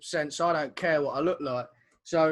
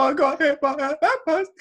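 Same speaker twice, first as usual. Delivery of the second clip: laughing; 6 semitones higher, 4 dB louder than usual. Retold or imitated. Retelling while crying, laughing.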